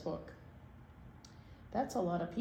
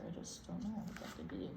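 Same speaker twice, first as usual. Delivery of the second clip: ordinary delivery, nothing marked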